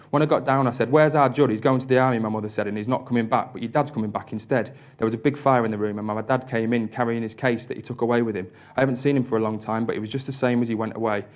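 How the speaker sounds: put-on breathless, unpunctuated child's story in your own accent; run-on reading. Shown as a demonstration. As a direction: read it straight through without pausing for punctuation. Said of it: "there's not much punctuation".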